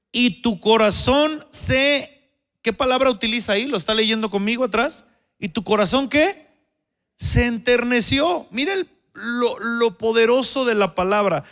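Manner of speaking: fuerte y más agudo, alargando las palabras; predicación intensa. Acted. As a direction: empuja a la congregación a responder. Preaching on the verse. (predicador exaltado)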